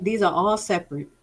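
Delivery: usual speaking voice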